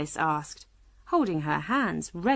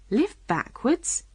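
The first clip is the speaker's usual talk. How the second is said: higher, slow astonished question; surprised; 6 semitones higher, slower than usual. A narrator's reading. Alice repeating the Queen's odd claim, surprised. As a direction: echo it in mild astonishment. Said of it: "repeated in great surprise".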